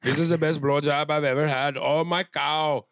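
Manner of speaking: lightly hammed-up pleasure, stretched at the end; playfully overacted bliss; mock ecstatic moan. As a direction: draw out the last words like a moan. Repeats the mock moaning bit.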